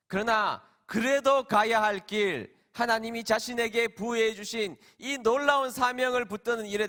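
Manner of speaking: high, forceful sermon climax; impassioned preaching. Real, not acted. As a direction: drive it home from the pulpit, pitched high. (높은 음으로 힘주어 외치는 설교)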